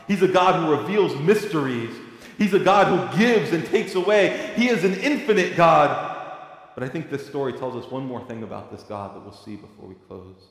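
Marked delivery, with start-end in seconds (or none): 0.1-6.1 high, loud, impassioned pulpit cry; preaching at full force. Acted. Preacher urging his congregation, preaching at full force. Proclaiming God's might.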